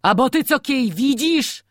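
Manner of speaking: put-on old man furiously shouting at his daughter-in-law. A narrator's reading. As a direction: bark it at her in fury. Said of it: "krzyknął z wściekłością".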